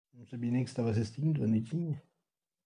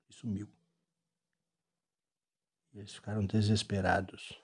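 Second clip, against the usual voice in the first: story narration. A narrator's reading. Father narrates Sonic story.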